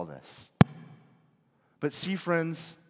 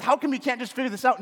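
mock berating, quick and sharp; harsh scolding; 8 semitones higher, 4 dB louder, faster than usual. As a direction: scold them like an impatient teacher. Imagined harsh Jesus berating disciples.

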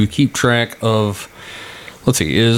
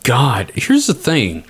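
ordinary talk, nothing marked

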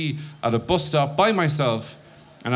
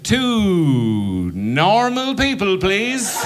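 very slow, higher, deliberately normal; awkward odd request; 7 semitones higher, 4 dB louder, much slower than usual. Retold or imitated. Stoned guy at ticket desk, very slow strained normal voice. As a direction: try way too hard to sound normal. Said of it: "So I said".

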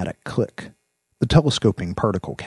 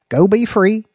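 higher, louder cheerful release; loud release call; 9 dB louder than usual. Acted. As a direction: call the dogs loose. Releasing the dogs.

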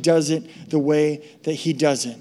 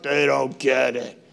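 grumbling disdain; 4 semitones lower, slower than usual. Retold or imitated. Disapproving God.

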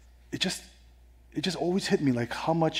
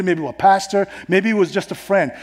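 ordinary delivery

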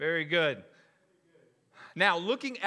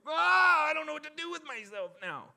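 high, loud shriek of fake panic; mock outraged shout; 13 semitones higher, 5 dB louder than usual. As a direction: wail it like a scandalised churchgoer. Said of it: "Christians were like".

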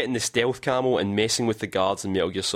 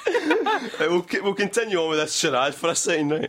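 laughing; 8 semitones higher than usual. Real, not acted. Laughing through the line.

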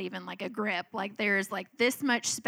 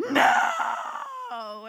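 put-on child's shrieked no. Daughter wailing at mom as the garage door shuts. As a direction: shriek it as a tantrum starts again.

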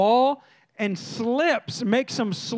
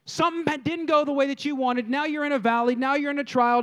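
usual speaking voice

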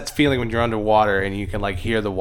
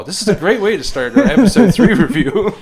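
laughing; 8 semitones higher, 8 dB louder than usual. Real, not acted. Laughs at their own tangent.

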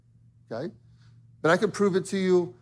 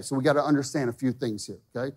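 ordinary talk, nothing marked

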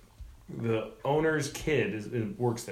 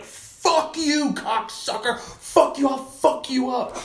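high, strained angry heckler imitation; mock drunken rage; 12 semitones higher, 3 dB louder, slower than usual. Drunk heckler stands up at the comic, high mock rage. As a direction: act out a drunk's threat, pitched way up.

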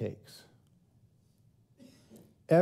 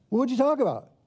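higher, louder mock-exasperated wife; 7 semitones higher, 3 dB louder, faster than usual. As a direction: push the question like a fed-up wife. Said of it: "your wife is gonna say".

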